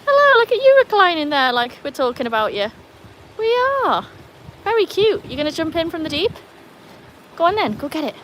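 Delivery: high, lively talk to a pet; playful high-pitched pet chat; playful dog talk. Real, not acted.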